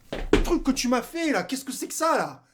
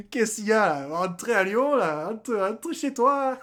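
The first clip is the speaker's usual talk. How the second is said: louder, with a slow laughing go home; gloating at the Lyon fans; laughing taunt; 4 dB louder than usual. Acted. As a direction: laugh as you shoo them home.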